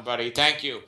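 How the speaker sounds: ordinary delivery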